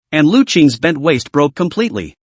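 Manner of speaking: usual speaking voice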